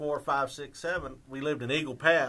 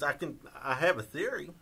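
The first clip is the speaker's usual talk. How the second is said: ordinary talk, nothing marked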